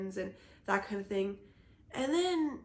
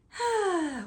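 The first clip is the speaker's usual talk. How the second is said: tired sigh; 10 semitones higher, 7 dB louder than usual. Real, not acted. Sigh after burnout talk.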